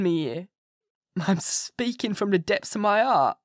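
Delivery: high, loud, frantic begging, slowing on the key words; a frightened asylum patient begging the doctor, rising to a cry; desperate pleading madman. Acted. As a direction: plead for your soul, on your knees, close to tears. Renfield begs to be released.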